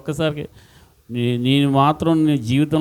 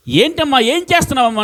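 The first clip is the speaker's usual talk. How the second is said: high, questioning villager voice, pitched up; puzzled onlooker; 10 semitones higher, 3 dB louder than usual. Retold or imitated. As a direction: ask the widow in disbelief, voice raised. Onlookers question the grieving widow. (గుండె బాదుకుంటున్న విధవను ప్రశ్నిస్తున్న ఊరివాళ్ళు, ఎత్తైన ఆశ్చర్య స్వరం)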